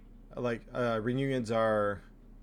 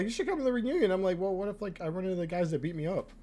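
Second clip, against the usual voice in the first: high-pitched retelling; 8 semitones higher than usual. Retold or imitated. Acts out worried reunion exchange.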